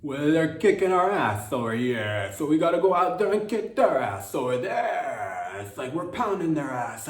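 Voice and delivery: loud, high, forced tough-guy rant; a stiff politician trying way too hard to sound tough; Gavin Newsom impression. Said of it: "this is my impression of Gavin Newsom".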